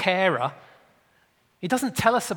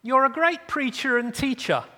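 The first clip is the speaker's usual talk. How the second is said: higher-pitched, politely sarcastic flattery; nicely veiled sarcasm; 5 semitones higher, 3 dB louder than usual. Acted. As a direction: pay the compliment nicely with irritation underneath. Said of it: "he says it quite nicely".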